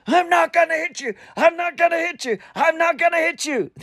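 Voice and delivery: high, strained imitation of a yell; retold shouting. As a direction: shout it over and over in someone's face. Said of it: "he shouted at me".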